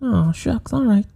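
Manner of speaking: put-on high child falsetto, apologetic; meek little boy David. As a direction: give in as a sheepish little boy. Plays the exorcised boy David.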